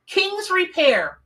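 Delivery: high, slow, drawn-out awe; amazed. Real, not acted. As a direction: savour each word in disbelief over "King's Repair". Rereads the truck name in awe.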